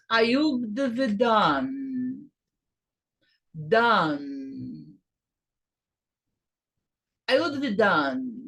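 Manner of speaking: "I would have done" said slowly and carefully; slow careful demo of the phrase; model pronunciation. Shown as a demonstration. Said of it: "essa é a pronúncia".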